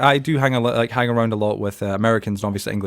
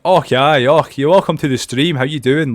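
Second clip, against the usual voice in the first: loud, higher broad Scottish greeting; 4 semitones higher, 5 dB louder, slower than usual. Shown as a demonstration. Streamer demonstrating his lost accent, broad Scottish. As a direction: exaggerate a hearty Scottish welcome. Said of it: "it doesn't sound like".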